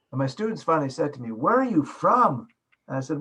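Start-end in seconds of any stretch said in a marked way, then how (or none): 1.3-2.5 louder, higher demand to know, pushing on "from"; insistent questioning. Retold or imitated. Students finally ask their teacher's origin, insistent questioning. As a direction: imitate baffled students demanding an answer.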